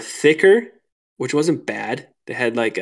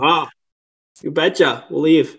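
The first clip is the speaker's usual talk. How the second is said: high, hammed-up Minnesota "you betcha"; Minnesota accent; 5 semitones higher, 4 dB louder than usual. Angler fobbing off the Walmart workers, mock Minnesota accent. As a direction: play dumb to the workers in a thick Minnesota accent. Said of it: "rip out the northern accent".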